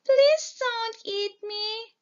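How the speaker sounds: high pleading little voice; little goat voice. A narrator's reading. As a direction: beg like a tiny scared goat. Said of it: "says the little goat".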